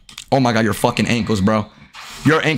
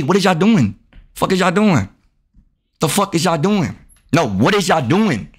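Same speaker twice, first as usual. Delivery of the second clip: ordinary delivery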